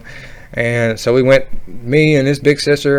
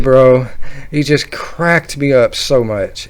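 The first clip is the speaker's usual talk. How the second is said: usual speaking voice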